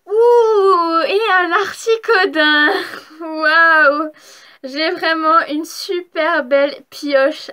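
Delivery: loud, excited gasps and a slow, delighted reveal; overjoyed at a shiny card; thrilled. Real, not acted. Vlogger shows a shiny card to viewers, loud thrilled gasps.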